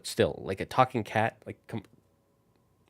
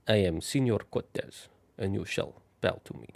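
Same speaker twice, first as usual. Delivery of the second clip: poorly attempted Spanish conquistador accent, softer; bad Cortez Spanish accent; 3 semitones lower, 6 dB quieter than usual. Shown as a demonstration. Streamer tries out Señor Cortez, shaky Spanish accent. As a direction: try an unpractised Spanish accent. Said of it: "maybe a Cortez kind of accent".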